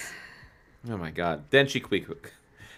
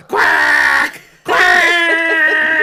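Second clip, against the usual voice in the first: loud shrieking high quacks; shouted duck quacks; 10 dB louder than usual. Acted. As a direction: squawk like a frenzied duck. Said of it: "With a hideous cry".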